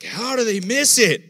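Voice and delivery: loud, slow, high disbelief; incredulous exclaim. Retold or imitated. Preacher voicing a reader of the Gospels, loud slow exclaim. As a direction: cry out how can they miss it. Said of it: "you're like".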